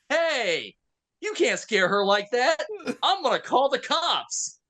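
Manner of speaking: high-pitched mock scolding; acted-out offended Canadian mother; indignant mother. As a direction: scold like an outraged mom, slowing on the threat. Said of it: "this poor Canadian mother".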